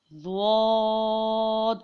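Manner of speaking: زواد drawn long with a heavy, rounded sound; thick, full zuad. Shown as a demonstration. Teacher demonstrating the letter, long heavy زواد. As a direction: fill the mouth and stretch زواد. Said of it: "زواد کو بھی موٹا اور پر پڑھا جائے گا".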